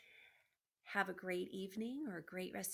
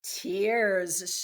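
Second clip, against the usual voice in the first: a loud, drawn-out gleeful shout; shouted tears; 13 dB louder than usual. Acted. The rats smelling fear, a loud gleeful shout. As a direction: bellow it like a pack on the scent. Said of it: "shouted the rats".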